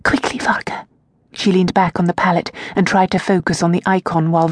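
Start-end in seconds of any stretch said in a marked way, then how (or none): 0.0-0.8 high, hushed and hurried; urgent whisper. Acted. Tasia urging the servant out, whispered. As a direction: whisper her away urgently. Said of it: "she whispered".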